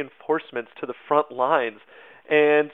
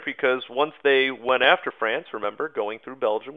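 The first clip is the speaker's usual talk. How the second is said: ordinary delivery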